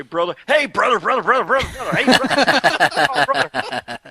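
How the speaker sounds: high, hammed-up brother chant, slowing at the end, laughing; mocking catchphrase. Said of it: "how many times those two guys will say".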